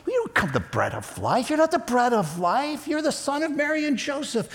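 high, put-on indignant griping; mock grumbling. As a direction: mimic a crowd scoffing at a claim. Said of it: "they're grumbling underneath their breath".

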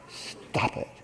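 softly whispered stop it; quiet firm stop. Retold or imitated. Parent correcting a young child, quiet firm stop. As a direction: say it low so the eyes carry it. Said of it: "You say".

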